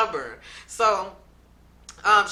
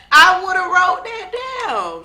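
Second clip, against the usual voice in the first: loud insistence; 11 semitones higher, 11 dB louder, slower than usual. Real, not acted. Insisting loudly.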